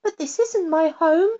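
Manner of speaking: lightly voiced girl, dismayed; little girl. A narrator's reading. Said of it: "said the little girl".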